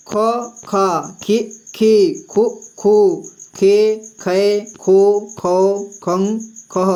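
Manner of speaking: reciting ख, खा, खी one by one with clear pauses; slow clear recitation of the ख row; slow syllable drill. Shown as a demonstration. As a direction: chant the ख syllables for a pupil. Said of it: "तो".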